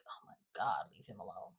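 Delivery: whispered plea to drop him; exasperated whisper. Real, not acted. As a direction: breathe it out under your breath, fed up. Exasperated aside to character.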